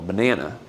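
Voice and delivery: banana with a lazy, low schwa in both unstressed syllables; soft reduced English banana. Shown as a demonstration. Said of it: "Es con E, dos veces E".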